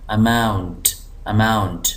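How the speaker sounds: Amount with mount pressed and falling; stress on MOUNT. Shown as a demonstration. Teacher demonstrating stress, heavy mount in amount. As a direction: lean on the second syllable mount in amount. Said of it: "इसको मैं ज़ोर दे रहा हूँ".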